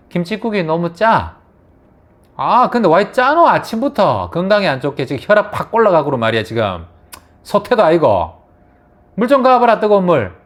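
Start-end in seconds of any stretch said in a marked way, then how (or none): 2.4-10.4 loud, high acted griping at his wife; rude grumbling husband. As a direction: complain and order her about, raised voice. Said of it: "기분 나쁘게 말했잖아, 남편이". (기분 나쁘게 투덜대는 남편 말투)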